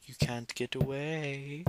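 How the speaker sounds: soft, slow taunt rising at the end; playful creepy killer. Pretend. Killer to a fleeing victim, creepy soft taunt. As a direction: taunt softly, drawn out.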